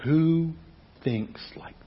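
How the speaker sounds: slow, awed whisper; hushed rhetorical question. Real, not acted. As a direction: breathe the question in wonder. Rhetorical question after scripture.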